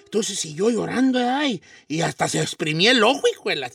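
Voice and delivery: a hushed, dramatic parable read to students; hushed story narration. A narrator's reading.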